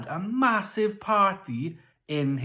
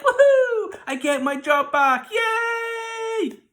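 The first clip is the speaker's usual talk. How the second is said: much higher, much louder whoops of joy; overjoyed cheer; 9 dB louder, slower than usual. Acted. Cupbearer cheering to Joseph about his job, ecstatic shouting. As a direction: shout it like you just got your job back. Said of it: "the cupbearer was super excited".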